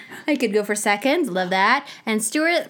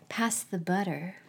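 soft low aside; 5 semitones lower, 11 dB quieter than usual. Retold or imitated. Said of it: "I said".

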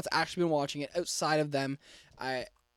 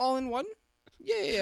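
family voice; 8 semitones higher than usual. Retold or imitated. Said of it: "me doing your entire family".

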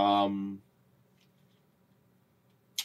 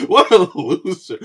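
slow and laughing, louder and higher; laughing mock insult; 7 semitones higher, 5 dB louder, slower than usual. Teasing a friend about his favorite hero, laughing. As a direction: drag it out through a laugh, teasing.

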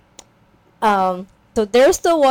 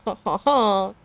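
deliberate, spelled-out fake chuckle; imitated hearty laugh; mock dad laugh. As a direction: put on a jolly, staged ha-ha-ha. Dad's hearty laugh acted out.

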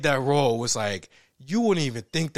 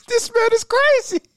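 much higher, loud laughing shock; laughing disbelief; 8 dB louder than usual. Retold or imitated. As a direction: crack up in amazement. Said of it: "it's just like".

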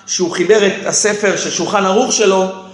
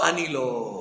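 very soft, low repeat of the quote; hushed echo; 7 semitones lower, 12 dB quieter, slower than usual. Retold or imitated. Teacher echoing pupil's denial, hushed and low. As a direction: drop right down, almost to nothing.

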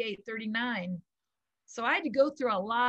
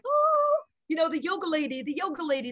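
put-on adoring kids; 7 semitones higher than usual. Kids greeting the yoga teacher, high excited gush. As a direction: squeal the first word like thrilled kids. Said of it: "they're like".

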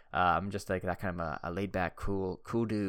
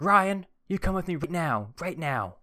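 mock stern teacher call, pitched up; 9 semitones higher, 5 dB louder than usual. Teacher calling Ryan in from recess, stern. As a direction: summon the kid firmly, half-hammed. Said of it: "our seventh grade teacher is like".

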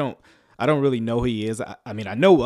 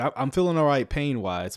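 usual speaking voice

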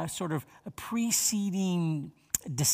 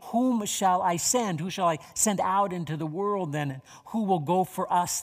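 scripture reading; 3 semitones higher, 3 dB louder than usual. Acted. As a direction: let each phrase of scripture land. Reads the Lord's words.

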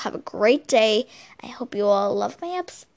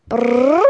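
loud slow rolled lips trill; vocal drum roll, falling; 7 dB louder than usual. Pretend. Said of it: "it's my drum roll".

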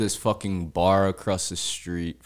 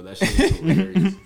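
laughing; 11 semitones higher, 4 dB louder, faster than usual. Real, not acted. Laughing at cold Florida boys.

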